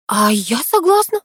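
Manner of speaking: bright-eyed, keen outburst; exclaiming. A narrator's reading. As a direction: exclaim it eagerly, jumping in. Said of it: "воскликнула я".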